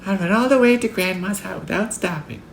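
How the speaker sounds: put-on very high little-girl voice; high-pitched child's eager promise. A narrator's reading. Little girl promises her mother.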